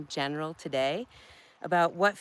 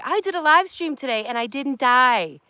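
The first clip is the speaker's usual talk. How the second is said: louder, higher proud announcement; mock proud post; 6 semitones higher, 5 dB louder than usual. Ladies bragging on Facebook after a live stream, mock proud. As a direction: play up the tiny triumph, tongue in cheek. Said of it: "elsewhere and say".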